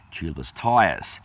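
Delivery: usual speaking voice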